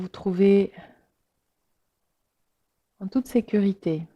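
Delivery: unhurried meditation voice with long pauses; guided relaxation. Real, not acted. Yoga teacher inviting listeners to let the body sink, guided relaxation. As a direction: guide listeners gently into rest, letting phrases settle.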